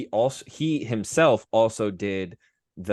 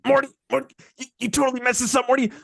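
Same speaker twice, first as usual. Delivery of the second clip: very high, louder, fast scolding cartoon voice; Rick Sanchez; 5 dB louder, much faster than usual. A mad scientist berating his grandson, high and fast. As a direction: rattle off a scolding at full speed. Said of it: "Rick Sanchez talking to Morty".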